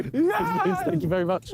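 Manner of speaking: laughing thanks. Real, not acted. Thrilled to get a lift.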